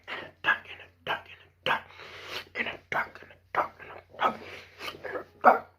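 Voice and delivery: breathy whisper, fading out. Pretend. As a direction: whisper it over and over. Repeated phrase fading to whisper.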